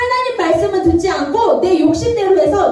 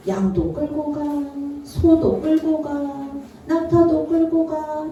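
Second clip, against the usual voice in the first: normal talking voice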